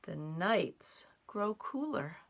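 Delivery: put-on slow, gentle read-aloud for children; story narration. A narrator's reading. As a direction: draw the little ones into the tale, unhurried. Reads a picture story aloud.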